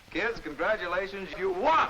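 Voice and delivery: rising to a shouted you won; excited announcement. Real, not acted. Host shouts the win to the kids, excited.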